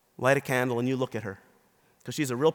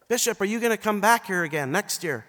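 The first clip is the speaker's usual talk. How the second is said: mock holler, higher pitched; hollering across; 7 semitones higher, 4 dB louder than usual. Woman to bishop across a busy restaurant, hollering. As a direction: call it out like a woman across a room. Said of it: "she hollered across the restaurant".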